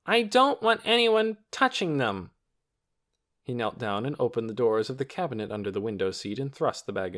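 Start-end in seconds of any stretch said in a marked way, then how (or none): 0.1-2.3 put-on high, loud possessive boy. A narrator's reading. Torin to everyone about his bag, loud and high. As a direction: warn everyone off. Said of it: "he said loudly".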